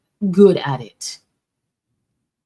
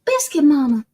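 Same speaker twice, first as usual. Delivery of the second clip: excited gasp; 9 semitones higher, 5 dB louder than usual. Real, not acted. Excited recognition of friend's name.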